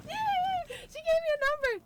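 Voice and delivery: high, loud burst of joy; thrilled squeal. Real, not acted. Telling her friend the office gave out a number, squealing with joy. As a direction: squeal with delight at the good news. Good news after a call.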